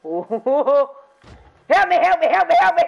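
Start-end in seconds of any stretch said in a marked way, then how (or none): 1.7-2.8 high, loud cries for help; laughing panic, shouting help; panicked shouts. Real, not acted. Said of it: "You could hear the fear in my voice".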